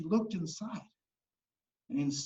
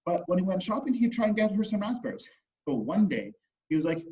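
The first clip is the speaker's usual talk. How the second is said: ordinary delivery, nothing marked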